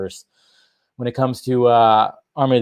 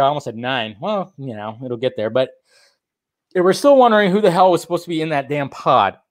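normal talking voice

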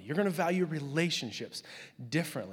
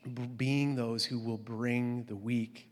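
ordinary talk, nothing marked

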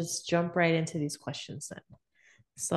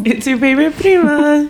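loud intro call; 10 semitones higher, 15 dB louder than usual. Acted. Podcast intro, called out loud.

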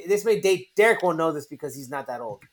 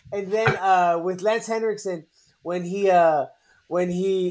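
usual speaking voice

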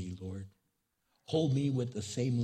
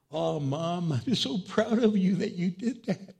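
high, loud proud voice with a laugh; proud family member; 8 semitones higher, 6 dB louder than usual. Pretend. Departed relative telling mom they are proud, laughing and loud. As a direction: beam with pride at mom. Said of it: "your family member would go".

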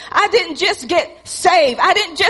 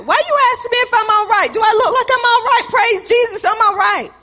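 higher and fast, irritated; defensive snap; 5 semitones higher, faster than usual. Retold or imitated. Pastor snapping at Teresa who asks if he is all right, fast and irritated. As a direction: bite back at the question.

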